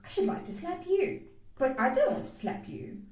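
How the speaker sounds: understated controlled anger; restrained fire. A narrator's reading. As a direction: hold back the urge to lash out. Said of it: "Restraining herself".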